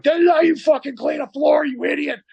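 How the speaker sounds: high, strained yelling imitation; enraged boss. Boss shows apprentice how to scrub a floor, enraged yelling. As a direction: imitate a boss shouting an insult mid-rage.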